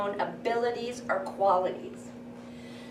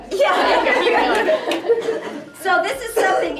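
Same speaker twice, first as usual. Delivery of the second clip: laughing; 11 semitones higher, 9 dB louder, much slower than usual. Real, not acted. Laughing after the counting exercise.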